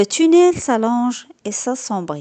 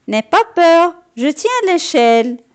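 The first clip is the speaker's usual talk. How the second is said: high, bright little-helper voice, raised in pitch; higher little Patapon voice; 7 semitones higher, 6 dB louder than usual. A narrator's reading. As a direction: reassure from below the ladder, pitched high. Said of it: "dit Patapon".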